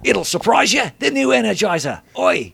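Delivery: high-pitched, rough attempt at an Australian ad man; mock Australian accent. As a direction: belt a bad Australian commercial impression. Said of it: "That's not an Irish accent".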